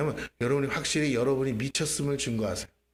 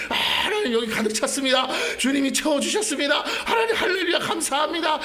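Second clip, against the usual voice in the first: very high, laughing mock-jubilant prayer; forced praise; 12 semitones higher, 3 dB louder than usual. Believer praising because the Spirit orders it, over-jubilant. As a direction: cry out exaggerated thanks through laughter. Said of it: "성령이 시키니까 하는 거야".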